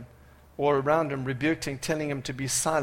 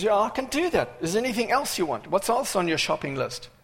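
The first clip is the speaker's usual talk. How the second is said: light, breezy, higher-pitched offer; Jesus; 5 semitones higher than usual. Acted. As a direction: start high and eager, like taking an order. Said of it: "Jesus says".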